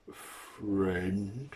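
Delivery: long drawn-out word, rising; slow questioning monster; creature's puzzled question. Acted.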